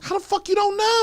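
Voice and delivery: high, loud incredulous outburst; can't believe what she's hearing; shrill-free disbelief at full pitch. Real, not acted. Incredulous at friend's excuse.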